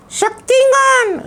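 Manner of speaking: put-on high, slow child puppet voice; puppet boy voice. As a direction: play the cheeky little boy puppet. Puppet names hero.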